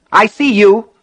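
high, loud outburst; sudden cry. A narrator's reading. Handcuffed Buster to the watching townspeople, crying out. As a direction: burst out loudly at the onlookers. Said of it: "Buster cried suddenly".

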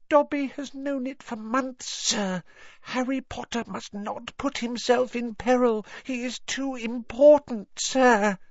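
high, pleading little elf; squeaky anxious house elf. Acted. Dobby the elf speaking.

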